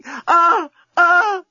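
high moans of passion; passionate cries. A narrator's reading. Lucy embracing the journalist, crying out in passion. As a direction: gasp out two high cries.

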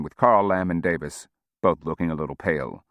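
ordinary delivery, nothing marked

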